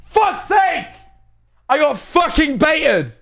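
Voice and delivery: high, loud outburst of rage; furious shout. Real, not acted. Streamer tricked into promoting merch, shouting furiously. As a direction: explode in exasperation, dragging out the words. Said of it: "I'm so pissed".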